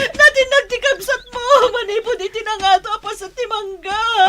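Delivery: high, sobbing distressed cry. Acted. Wife cries to Jojo that his brother fell. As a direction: wail the news through tears. Wife reports husband's fall.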